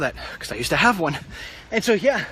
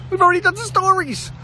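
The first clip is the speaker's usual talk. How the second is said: squeaky high teasing complaint; high mock whine; 4 dB louder than usual. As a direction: raise the pitch, whine it. Teasing complaint about old news.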